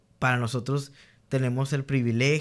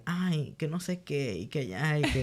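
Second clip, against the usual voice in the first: sassy attitude; 3 semitones higher, 5 dB quieter than usual. Retold or imitated. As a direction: toss it off like a confident schoolgirl. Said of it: "tiene así como esta actitud como de".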